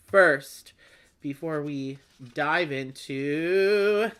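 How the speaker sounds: usual speaking voice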